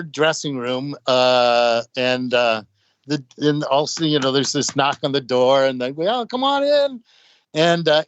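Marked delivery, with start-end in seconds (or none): 6.1-7.0 high singsong call, retold. Band answering a knock on dressing room door, light high call. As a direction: call lightly through a door in a raised pitch.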